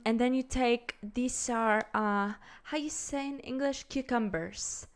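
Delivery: mock a Latin accent, searching for English; imitated Latin accent. Host mimics her on a cooking show, hammed-up Latin accent. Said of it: "thick Latin accent".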